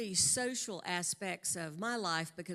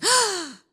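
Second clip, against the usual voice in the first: sharp, much higher and louder gasp; gasp of delight; 13 semitones higher, 13 dB louder than usual. Retold or imitated. Girl seeing the new car at the door, loud gasp. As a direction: let out a loud, high gasp of surprise. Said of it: "my friend runs to the door and goes".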